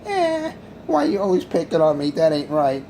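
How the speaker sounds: high-pitched mock wailing, then a whiny complaint; crying Pedro. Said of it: "crying Pedro".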